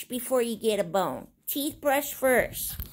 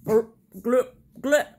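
burp and gulp; 4 semitones lower, 5 dB louder than usual. Pretend. Swallowing noise in song.

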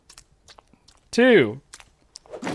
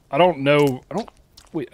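ordinary talk

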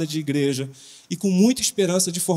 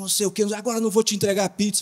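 retold angry retort; 5 semitones higher, much faster than usual. Angry delivery man retold.

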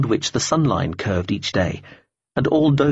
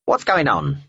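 a croaky talking bird, pitched up; croaking bird; 3 semitones higher than usual. Acted. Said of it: "it croaked".

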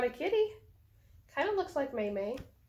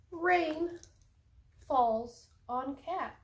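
stretched slow, higher start on rain; slow dramatic reading; 6 semitones higher, 3 dB louder, much slower than usual. A narrator's reading. Reading to cats, slow suspenseful narration. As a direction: draw it out for suspense.

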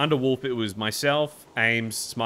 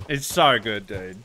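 ordinary talk, nothing marked

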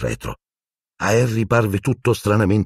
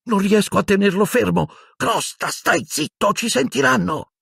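panicked. A narrator's reading. Ron struggling with panicking rat.

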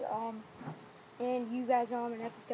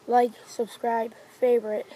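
loud slow outro call; 12 dB louder than usual. Real, not acted.

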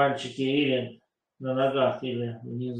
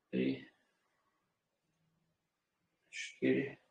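slow breath counting; 7 dB quieter, slower than usual. Shown as a demonstration. Counting breaths through the wave.

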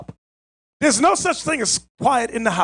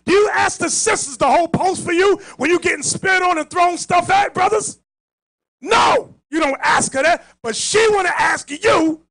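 preaching shout; 6 semitones higher than usual. Real, not acted. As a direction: hammer the brothers with it.

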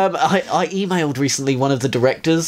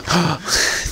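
theatrical gasp of dread; mock horrified gasp; 3 semitones higher than usual. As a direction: gasp as if trapped, playfully. Mock horror at door being bolted.

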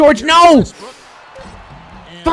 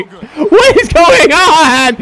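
slow, laughing yell of disbelief, higher; laughing shout; 6 semitones higher, 4 dB louder, much slower than usual. Real, not acted. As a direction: laugh it out, stretched and loud. Frantic gameplay, laughing in disbelief.

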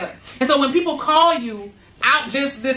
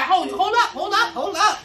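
mock hold up; 5 semitones higher than usual. Pushing back on people pulling her away, mock high protest. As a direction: stop someone mid-request. Mock reaction to outside demands.

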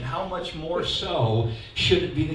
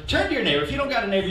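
usual speaking voice

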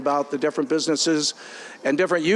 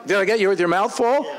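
laughing; 4 semitones higher, faster than usual. Real, not acted. Teasing a member mid-bite.

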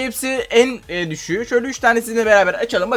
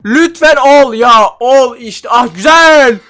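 begging louder and higher each time, then a cry of relief; building pleading; 4 semitones higher, 6 dB louder, slower than usual. Real, not acted. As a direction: plead with the roll until it hits. Şansa yalvarıp sonra seviniyor. (giderek yükselen yalvarış, sonra sevinç)

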